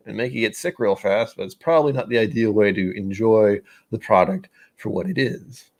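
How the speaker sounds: ordinary talk, nothing marked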